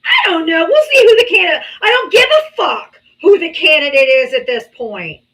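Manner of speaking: yelling at full force, voice cracking; furious shout. Real, not acted. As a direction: explode at them, loud and raw. Furious rant at a non-voting guest.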